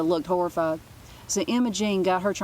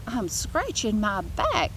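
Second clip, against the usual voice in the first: put-on slow, slightly higher bratty girl; sly kid; 4 semitones higher, slower than usual. A narrator's reading. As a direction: drawl it out innocently. Emma Jean answers nurse.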